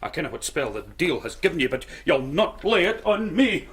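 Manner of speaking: ordinary talk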